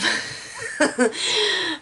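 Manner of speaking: sudden high, loud laugh; high burst of laughter. Real, not acted. Vlogger admitting she cheered too, laughing. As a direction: laugh out loud, embarrassed but happy.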